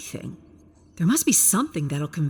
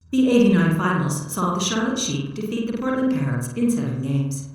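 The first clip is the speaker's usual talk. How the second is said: louder, even machine voice reciting facts; robot unit voice; 4 semitones higher, 6 dB louder than usual. Acted. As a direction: read it out like a literal-minded robot. Computer states finals result.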